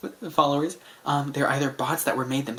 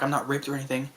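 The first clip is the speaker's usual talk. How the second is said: ordinary talk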